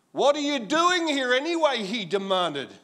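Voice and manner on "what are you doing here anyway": put-on loud, high scolding older brother; angry demand. A narrator's reading. Eliab scolding younger brother David, loud angry demand. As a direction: tear into the kid brother. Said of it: "he was angry".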